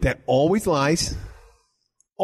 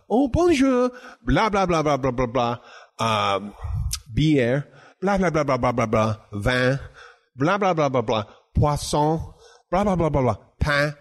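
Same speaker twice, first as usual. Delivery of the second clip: mock French accent. Diners answering him in French he barely follows, mock French accent. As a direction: imitate French chatter with only stray words caught. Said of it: "I heard that they had a French accent".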